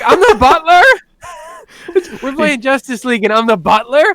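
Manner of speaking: outraged at the demotion but cracking up; dad laughing as he protests being the butler; laughing disbelief. Retold or imitated. Dad cast as butler, indignant.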